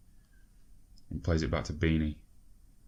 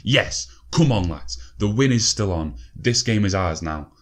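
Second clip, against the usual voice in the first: loud, fired-up cheer; rally the team after scoring; loud triumphant shout; celebrating; 3 semitones higher, 7 dB louder than usual. Real, not acted. Celebrates an equaliser.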